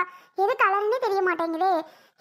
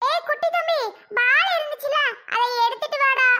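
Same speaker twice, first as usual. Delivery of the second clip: high-pitched call to little brother; 7 semitones higher than usual. Acted. Big sister calling little brother to fetch a toy, calling out. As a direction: call across to him, pitched well up.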